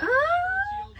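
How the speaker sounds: high squealing gasp of delight; gasp of joy. Retold or imitated. Mother retelling her surprise at money, delighted high squeal. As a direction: squeal like you just found treasure. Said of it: "I was like".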